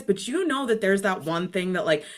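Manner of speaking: ordinary talk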